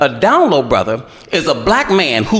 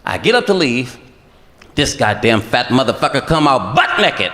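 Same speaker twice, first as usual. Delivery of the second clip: ordinary delivery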